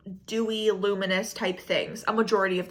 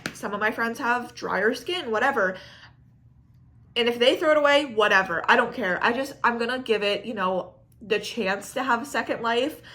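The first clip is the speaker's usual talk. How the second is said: ordinary delivery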